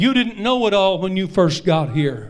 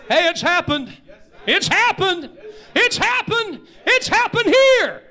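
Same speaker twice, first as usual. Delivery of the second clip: climbing from high to very high and louder, with a laugh; a preacher reaching the peak of his point; rising preaching cry; 10 semitones higher, 4 dB louder than usual. Real, not acted. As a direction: build each it's happened higher until it breaks into a cry.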